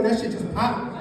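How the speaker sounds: usual speaking voice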